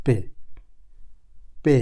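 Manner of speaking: teacher modelling পে for the German alphabet; German letter sound. Shown as a demonstration. German P sound for learners. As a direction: say পে like the German letter P, short and clear. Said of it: "এখানে হেকার".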